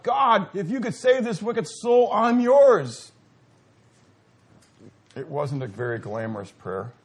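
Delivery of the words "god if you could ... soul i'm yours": crying out to God. Retold or imitated. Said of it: "I cried out".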